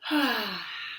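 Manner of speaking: a long soft exhale after the big breath. Shown as a demonstration. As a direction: let the breath go with a sigh. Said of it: "Big breath".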